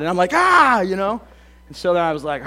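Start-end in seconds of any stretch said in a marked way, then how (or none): 0.3-0.8 high, loud mock cry of alarm; startled yelp. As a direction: yelp as if jolted out of prayer. Startled father reacting to yelling.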